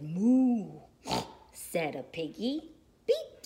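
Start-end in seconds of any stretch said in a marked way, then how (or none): 0.0-0.8 loud, drawn-out moo; cow moo. A narrator's reading. Cow greets the truck, big moo. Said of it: "Cow said". 3.1-3.4 high, short horn beep; little truck beep. A narrator's reading. Blue greets the toad, bright high beep. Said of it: "said Blue".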